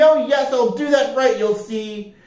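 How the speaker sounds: high, eager storybook promise; excited little recruit voice; eager cry. A narrator's reading. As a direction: promise keenly, pitched well up. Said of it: "cried Bea".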